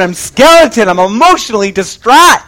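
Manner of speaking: normal talking voice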